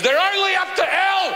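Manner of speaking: high, dazed cry; stunned dad. Retold or imitated. Dad stumbling out of the show, high and dazed. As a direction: gasp it out like a broken man. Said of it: "stumbles out and goes".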